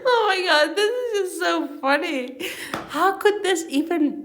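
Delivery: high, helpless exclamation through laughter; real laughing. Real, not acted. Reader overwhelmed by the funny book, laughing. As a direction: gasp it out while cracking up. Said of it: "Excuse me for all the laughter".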